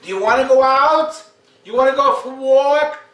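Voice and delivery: high, loud, rising sing-song questions to the dog; high questioning baby talk. Shown as a demonstration. Dog trainer mocking owners, high sing-song questions. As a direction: ask the dog sweetly like a baby. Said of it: "Don't do that".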